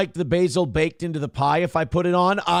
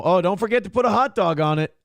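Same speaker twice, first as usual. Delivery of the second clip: ordinary talk, nothing marked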